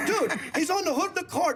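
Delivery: chuckling repeat; laughing. Real, not acted.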